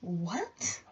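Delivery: soft, questioning rise; baffled look voiced. Teacher puzzled by student's cake question, softly baffled. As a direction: say it small and puzzled, imitating a bemused reaction. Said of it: "gave me this look as if to say".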